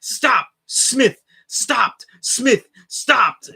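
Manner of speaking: high, loud sportscaster call of a blocked shot; Marv Albert call. Retold or imitated. Recalling a playoff broadcast, hammed-up announcer yell. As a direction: belt it out like a TV play-by-play announcer. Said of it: "all I could hear in my head was Marv Albert going".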